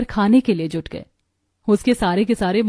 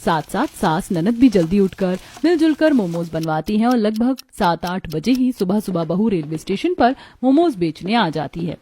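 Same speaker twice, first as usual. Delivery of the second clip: usual speaking voice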